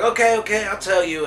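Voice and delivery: high, loud imitation of giving in; acted-out pleading; mock frantic surrender. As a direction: give in hastily, as if under threat. Wounded director gives in, acted out.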